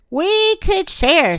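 high, slow, drawn-out suggestion; coaxing offer. A narrator's reading. Great Pig bargaining with the Great Bear, coaxing. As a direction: stretch it out high, as a tentative offer.

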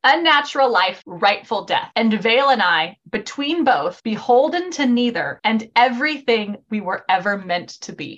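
measured phrases, each falling at the end; solemn quote reading. Acted. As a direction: read it like verse, pausing after each phrase. Reads closing quote aloud.

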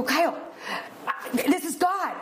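hammed-up awe at a miracle; excited wife. Telling her husband the cash is from God, high and awed.

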